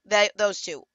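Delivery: normal talking voice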